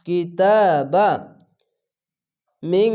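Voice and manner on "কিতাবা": কিতাবা slow with the final a held; long a stretch. Shown as a demonstration. Teacher demonstrating alif length, slow chant. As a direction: hold the a of কিতাবা one beat. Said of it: "এক আলিফ টেনে পড়বেন".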